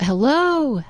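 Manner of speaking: called out high into the woods; calling out. Retold or imitated. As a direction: call hello toward the trees. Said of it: "he called out".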